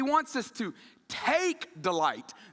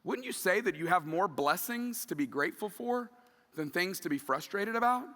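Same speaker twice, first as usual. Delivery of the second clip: ordinary talk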